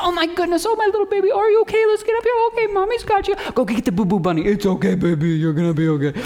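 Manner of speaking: high, fussing mommy voice; soothing a child. Retold or imitated. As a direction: coo like a worried mom. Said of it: "It's like".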